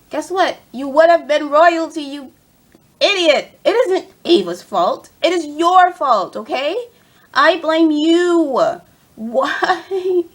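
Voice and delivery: high, loud mock-outraged rant; heated blaming. Fan telling Cora it is her fault, heated. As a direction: lay the blame loudly, stretching key words.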